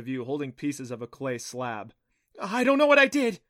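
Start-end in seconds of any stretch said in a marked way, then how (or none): 2.4-3.4 high, loud panicked outburst; breathless alarm. Acted. Clancy after shattering the clay slab, high loud alarm. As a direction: blurt it out in a shrill-pitched panic. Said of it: "she breathed".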